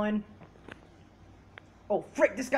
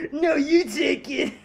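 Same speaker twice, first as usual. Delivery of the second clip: high, drawn-out refusal with a laugh; 5 semitones higher, 4 dB louder, slower than usual. Real, not acted. Player squabbling with a friend over the controller, laughing protest. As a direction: stretch it out high, half laughing.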